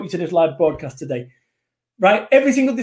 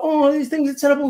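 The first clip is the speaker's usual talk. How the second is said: high-pitched mock whinge; whinging; 8 semitones higher than usual. Motivational speaker mimicking people who moan, mock whinging. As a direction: imitate a moaner complaining in a higher voice. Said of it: "So stop whinging about".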